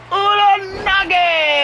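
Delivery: hyped move call. Real, not acted. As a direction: drag the move name out like a play-by-play call. Calling the signature move.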